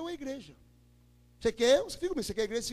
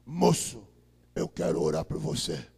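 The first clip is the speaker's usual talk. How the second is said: much lower, booming pastor voice; imitating a deep-voiced pastor; big deep voice; 5 dB louder, slower than usual. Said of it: "Com a vozona bonita de negro".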